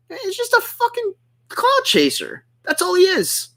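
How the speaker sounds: very high, exasperated dismissal; pissed off. Real, not acted. Streamer branding his rival a clout chaser, pissed off. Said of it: "before I just get pissed off".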